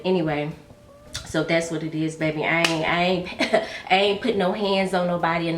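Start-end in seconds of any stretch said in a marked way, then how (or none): none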